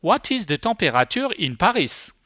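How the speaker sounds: French r and French vowels in temperature and Paris; thick French accent. Shown as a demonstration. Presenter retries the voice search, in thick French accent. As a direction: repeat the query with a heavy French accent. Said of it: "I was working with a very thick accent".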